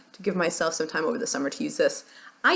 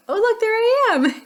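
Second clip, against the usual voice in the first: surprised; 5 dB louder than usual. Real, not acted. Spots herself in the packaging.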